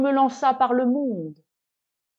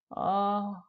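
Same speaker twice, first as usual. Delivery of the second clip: ordinary delivery, nothing marked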